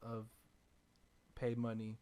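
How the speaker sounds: ordinary talk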